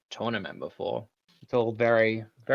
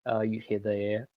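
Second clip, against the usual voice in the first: ordinary delivery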